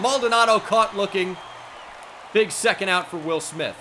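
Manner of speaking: loud, high follow-up to the strikeout; excited call. Real, not acted. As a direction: keep the celebration going as you name the out.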